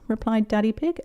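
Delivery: usual speaking voice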